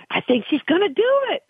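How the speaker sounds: high, hopeful race-watcher's cheer; excited anticipation. Retold or imitated. Trainer reliving watching her filly race, excited and hopeful. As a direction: voice climbing with excitement as she watches. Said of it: "you just get so excited".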